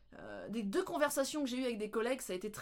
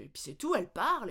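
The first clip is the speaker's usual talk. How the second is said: ordinary delivery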